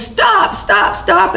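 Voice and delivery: high piercing scream of stop; retold scream. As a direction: scream it high to make them stop firing. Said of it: "and she screams".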